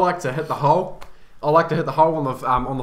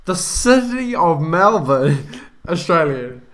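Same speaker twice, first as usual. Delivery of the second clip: slow, halting speech, drawn out word by word; struggling to speak; slower than usual. Real, not acted. Cohost trying to state a fact with headphones on, struggling to speak. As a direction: fight through the words, stretched and stumbling. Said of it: "That is so hard to speak".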